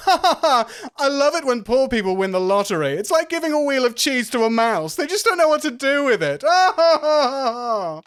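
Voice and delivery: high, loud crowing with big laughs; obnoxious rich braggart. Acted. Rich athlete mocking the bar, loud high braggart voice. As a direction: crow it loudly, high-pitched, laughing at your own joke. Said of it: "gestures towards everybody and goes".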